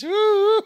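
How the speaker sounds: high loud woo like a siren; mock siren whoop. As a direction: whoop like police coming. Mock police siren call.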